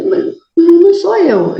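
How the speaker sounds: high, slow, tearful protest; frightened denial. Retold or imitated. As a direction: plead that it isn't you, close to tears. Scared denial at the boarding house.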